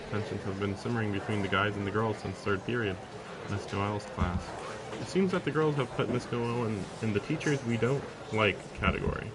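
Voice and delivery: usual speaking voice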